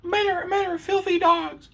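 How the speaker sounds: sneering falsetto imitation of a woman, laughing; mocking a woman. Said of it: "And she's gonna say".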